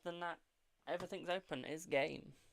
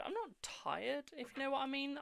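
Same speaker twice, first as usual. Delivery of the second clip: playful; 9 semitones higher than usual. Real, not acted. Playful denial of being tired.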